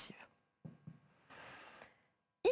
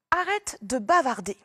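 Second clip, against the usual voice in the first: louder, firm command; stern order; 2 semitones higher, 7 dB louder than usual. Shown as a demonstration. Teacher ordering a pupil to stop chatting, loud. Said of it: "C'est un ordre".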